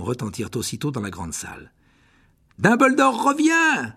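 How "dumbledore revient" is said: cri joyeux, aigu et lent. Acted. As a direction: crier la bonne nouvelle avec joie. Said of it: "d'un ton joyeux". (élèves ravis)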